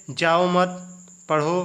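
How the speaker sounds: जाओ मत said slowly, a long pause, then पढ़ो; pause after मत. Shown as a demonstration. Teacher showing how a comma changes meaning, pause after मत. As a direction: break after मत, before पढ़ो. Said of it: "विराम चिन्ह का प्रयोग न होने से".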